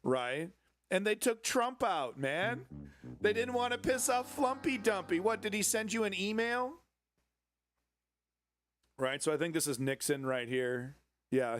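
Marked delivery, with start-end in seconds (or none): 0.9-6.7 mocking outrage. Real, not acted. Outraged mocking rant at Trump.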